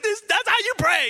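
high, loud, thrilled exclamation; retold hype, bursting with admiration; so excited. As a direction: squeal it like an overexcited fan. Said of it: "so excited".

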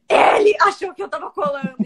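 loud wailing protest, hammed-up; mock crying shout. Student pretending to cry to classmates, loud mock wail. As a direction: fake sobbing outcry. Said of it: "eu saí chorando".

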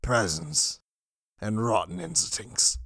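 sagging, dejected and low; deflated. A narrator's reading. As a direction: let the spirit drain out. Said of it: "his spirits sagged".

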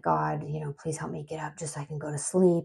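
soft, pleading prayer under the breath; hushed prayer. Retold or imitated. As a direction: beg God quietly, half-voiced. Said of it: "I remember praying".

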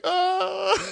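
high, drawn-out mock whimper; scared groan. Storyteller replaying his reaction to the cop, mock scared. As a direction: let out a long, high, scared "oh". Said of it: "I'm just so scared".

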